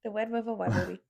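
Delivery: "Red River rivalry" teased as "Wed River waiawee"; mocking his slur. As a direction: copy his slurred "rivalry" with w sounds, laughing. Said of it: "I love when you don't say words right".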